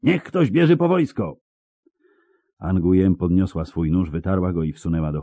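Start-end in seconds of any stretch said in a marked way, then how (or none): 0.0-1.4 high-pitched urgent call; high, contained cry; calling out alarm. A narrator's reading. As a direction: call across the crowd for help. Miner calls out for soldiers.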